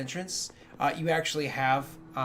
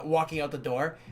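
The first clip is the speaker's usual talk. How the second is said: ordinary delivery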